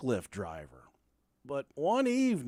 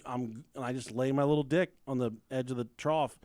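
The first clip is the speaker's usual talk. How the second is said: usual speaking voice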